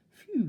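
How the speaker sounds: big loud sigh of relief; relieved phew. A narrator's reading. Mister Jelly relieved the world didn't end, loud phew. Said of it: "he said".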